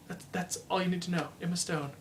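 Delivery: hushed admiration. Real, not acted. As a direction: let the name hang in quiet admiration. Still swooning over Emma Stone.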